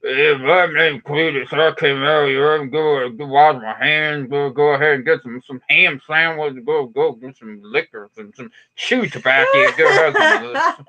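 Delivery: put-on slow drawling Southern accent. Mocking Southern folks for the viewers, a slow Southern accent. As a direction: play a hammed-up Southern yokel named Cletus. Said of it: "the gummy Southern accent".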